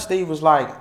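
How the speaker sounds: usual speaking voice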